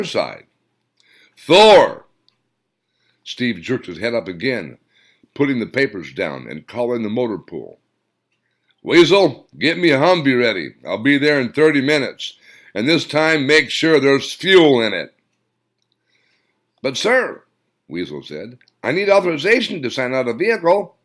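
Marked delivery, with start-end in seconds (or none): 1.4-2.1 loud sudden call; shouted name. Acted. 8.8-15.1 loud commanding phone order; barked order. Acted. 16.8-17.4 protesting sergeant. Acted. 18.8-20.9 hurried sergeant. Acted. Sergeant protesting.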